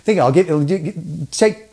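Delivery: ordinary talk, nothing marked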